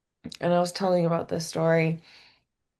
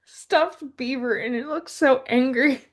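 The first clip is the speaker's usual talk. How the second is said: usual speaking voice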